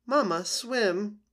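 small gosling coaxing his mother; little gosling. Acted.

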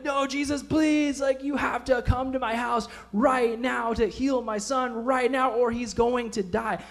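high, slow, shouting plea; father shouting at Jesus to come now; desperate plea. Retold or imitated. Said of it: "I'd grab him and I'd be like".

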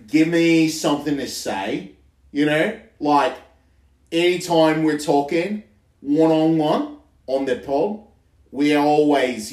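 slow, drawn-out Australian accent. Shown as a demonstration. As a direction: put on a leisurely Aussie drawl. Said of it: "I gotta get in the mood".

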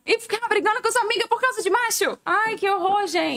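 high-pitched scolding outburst; scolding rant. Acted. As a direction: tell them off like an outraged aunt. Scolding the friends, acted outburst.

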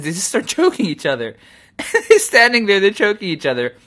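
amused, high and loud through laughter; laughing. Real, not acted. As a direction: laugh while telling an absurd sight. Laughs while describing the standoff.

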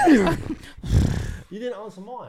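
high, very slow whine; mock whine. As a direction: drag it out like a sulky child. Mock whiny insistence.

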